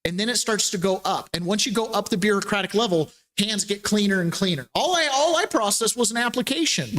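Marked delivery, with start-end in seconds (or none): 4.8-7.0 higher-pitched imitated official; mock excuse. Bureaucrat disclaiming blame for the fraud, high-pitched mock excuse. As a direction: plead innocence as the bureaucrat.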